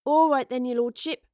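loud, high, hearty call. A narrator's reading. Astrid wakes the dazed Bosie, calling out. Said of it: "Astrid called to the awakening Bosie".

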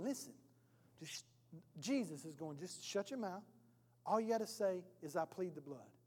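low, very soft aside; hushed counsel. Acted. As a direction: quietly shush the client and coach them. Advocate quietly coaching the accused.

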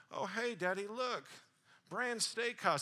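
put-on high, loud excited little boy; imitated excited small child; five-year-old son. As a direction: call out like a kid reading billboards. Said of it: "He's like, you know, five years old".

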